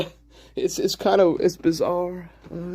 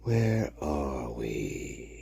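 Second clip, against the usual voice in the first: lower, very slow and drawn out; slow low drawl; 12 semitones lower, 5 dB quieter, much slower than usual. Shown as a demonstration. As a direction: stretch each word out low and slow. Repeats the question, drawn out.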